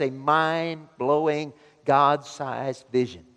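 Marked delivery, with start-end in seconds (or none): none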